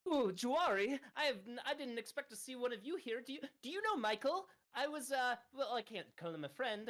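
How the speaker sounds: high, eager, rushing fairy voice; excited high character voice. Acted. Hubert excitedly greeting the ox at the front desk, high and eager. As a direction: gush over meeting a fellow tribesman.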